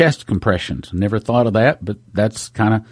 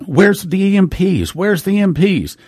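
higher-pitched, impatient calling for help; retold frantic question, pitched up; urgent demand; 6 semitones higher than usual. Bystander demanding the paramedics hurry, urgent.

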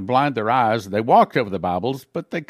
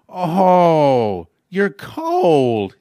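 loud, higher, sarcastic pity; mock sympathy; 3 semitones higher, 6 dB louder, much slower than usual. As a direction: fake concern from the torturer. Torturer mocking frozen prisoner.

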